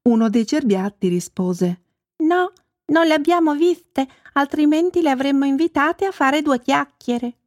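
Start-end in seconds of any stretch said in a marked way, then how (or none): 2.2-7.4 high little animal voice; chatty fawn. A narrator's reading. Fawn answering Babbo Natale, small high voice. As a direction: answer lightly as a young fawn.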